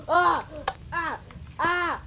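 short, high, loud shrieks; frightened screams. Real, not acted. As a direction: scream in quick bursts of fright. Screams on seeing the monster.

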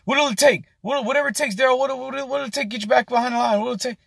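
high, frantic, pleading manager; panicked manager pleading. Retold or imitated. Manager begs cook not to leave, high frantic plea. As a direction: squeal it like a boss losing it. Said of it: "looking at him like".

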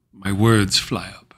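low, soft, spent murmur; hushed resignation. Acted. The sinner admits his prayer has failed, hushed resignation. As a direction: let it sink away, defeated, after a sigh.

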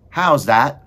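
loud, high shout; shouted question. A narrator's reading. Meg asks Cook to repeat it, shouting. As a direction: shout it as if you didn't catch it. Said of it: "shouted Meg".

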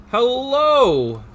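high, loud hello; excited greeting. Real, not acted. Streamer greeting a returning viewer, excited shout. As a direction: call out a big happy hello.

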